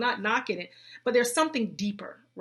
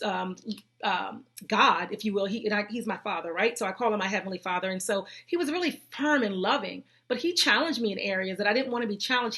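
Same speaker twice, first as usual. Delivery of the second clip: normal talking voice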